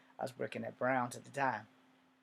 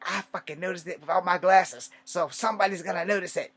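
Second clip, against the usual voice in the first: scolding aunt; 6 semitones higher, 8 dB louder than usual. Retold or imitated. Aunt argues back.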